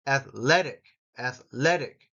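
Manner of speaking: "Athletic" with emphasis on "le"; stress on second syllable. Shown as a demonstration. Teacher showing which syllable gets emphasis, stressed second syllable. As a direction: punch the "le" in each "Athletic". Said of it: "le gets emphasis".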